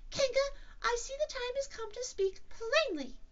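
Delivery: very high, squeaky bravery; trying to be brave. Acted. Piglet dreading the bath, as brave as he can. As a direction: speak up loudly in a tiny, determined voice. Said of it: "in as brave a voice as he could".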